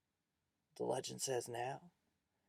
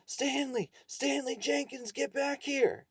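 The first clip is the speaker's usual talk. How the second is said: high, urgent far-carrying yell; 13 semitones higher, 6 dB louder, slower than usual. A narrator's reading. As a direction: yell after a runaway pupil.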